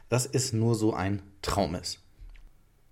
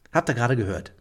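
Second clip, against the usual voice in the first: normal talking voice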